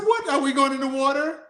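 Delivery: high, startled question; bewildered kid handed a life jacket; surprised child. Retold or imitated. Boy at JFK, retelling his alarm at the life jacket, high and startled. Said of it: "And I said".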